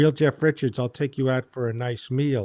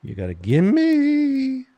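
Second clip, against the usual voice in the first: mocking Bruce; 12 semitones higher, slower than usual. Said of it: "He goes".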